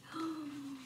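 quiet mock gasp; soft gasp. Teacher gasping at the porridge for children. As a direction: gasp softly in surprise. Teacher reacts to overflowing pot.

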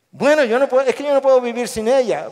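loud, high-pitched exasperated plea; protesting husband. Acted. Husband pleading his love to the pastor, emphatically. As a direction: blurt it out as a cornered husband. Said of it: "un esposo dice".

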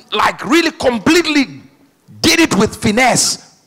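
loud, high-pitched full-force preaching. Real, not acted. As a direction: hammer each phrase out to the congregation.

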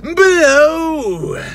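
high, loud screamed word. Acted. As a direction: scream it like a metal frontman. Channel intro, pointing to description.

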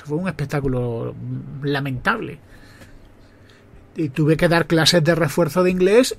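ordinary delivery

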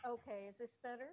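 faint and rushed, far from the mic; quick words too far away to hear; barely audible. Real, not acted. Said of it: "your voice is very faint".

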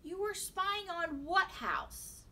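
lightly voiced mother probing her son; questioning mother. A narrator's reading. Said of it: "his mother asked".

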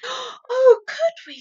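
high, loud delighted cry; a hungry girl thrilled by the offer; crying out eagerly. Acted. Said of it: "Sophie cried".

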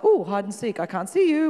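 a hint of a silly hide-and-seek game; mock playful God. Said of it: "He's not like".

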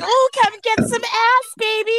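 high, teasing come-on; mock seductive. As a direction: tease him playfully. Acting sexy quote.

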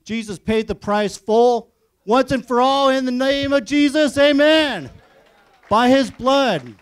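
loud, high preacher's cry; rising sermon close, loud and slow at the end; full-force preaching. Acted. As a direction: preach it out to the whole room. Preaching climax with amen.

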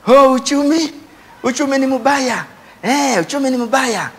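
usual speaking voice

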